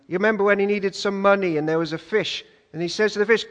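ordinary talk, nothing marked

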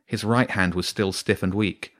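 usual speaking voice

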